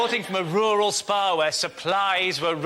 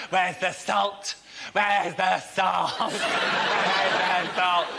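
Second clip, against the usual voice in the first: slow, drawn-out lament, stretched further each time; mock-woeful plea; much slower than usual. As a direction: moan it slowly as a mock national crisis. Mimics news fretting over salt.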